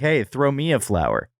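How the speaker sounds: usual speaking voice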